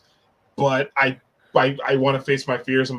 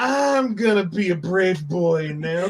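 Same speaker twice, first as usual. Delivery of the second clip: mock brave boy; 5 semitones higher than usual. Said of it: "I'm like".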